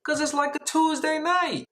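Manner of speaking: high-pitched incredulous complaint; exasperated protest. Retold or imitated. Driver protesting to stalling friends, high exasperation.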